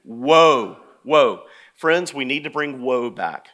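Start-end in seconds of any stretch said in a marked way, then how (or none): 0.0-1.4 loud, low, held WOE; weighty, relished call-out; group-prompt woe. Shown as a demonstration. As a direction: lead the room in a big woe. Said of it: "let's say this together".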